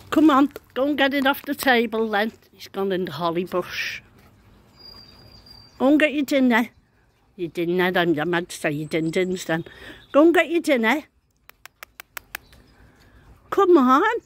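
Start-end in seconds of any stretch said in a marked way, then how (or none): 0.1-2.3 raised, higher call to coax a robin out; calling to a bird. Real, not acted. Coaxing the robin to the table, raised call. 5.8-6.7 higher, louder coaxing call. Real, not acted. Calling the robin to its dinner, coaxing. 10.1-14.2 much higher, louder sing-song call; high coaxing call. Real, not acted. Calling the robin in a high sing-song voice.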